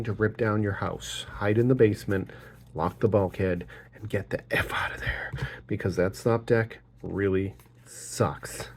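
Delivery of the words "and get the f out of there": a conspiratorial hushed aside; whispered. Real, not acted. Warning viewers away from stop decks, whispered.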